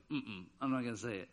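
put-on low, soft child's refusal; quietly refuse, voice dropped; hushed refusal. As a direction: imitate a reluctant kid keeping mum. Child refusing to say bad word.

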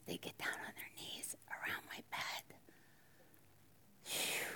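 hushed whisper, barely voiced. Preacher whispering to the congregation about praying friends.

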